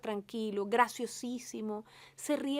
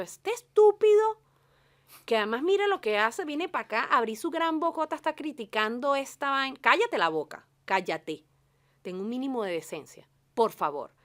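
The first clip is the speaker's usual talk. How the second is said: mock angry rant; 2 semitones higher, 7 dB louder than usual. To a director bad-mouthing a colleague, furious mock rant. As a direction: tell the gossiping director to shut up, hammed-up outrage.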